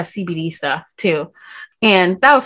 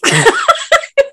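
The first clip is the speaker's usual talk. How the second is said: high squeal of laughter; 4 dB louder than usual. Real, not acted. Laughing about being high.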